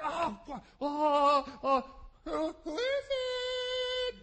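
high, stretched, nervous who is it; jumpy at the knock, voice climbing high; scared. Retold or imitated. Frightened disciples answering the knock, high and scared. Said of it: "and we're like".

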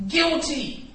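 loud high "Guilty"; declaring guilty. Acted. Defendant pleading guilty in court, loud and high. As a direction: blurt the plea out. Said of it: "you're like".

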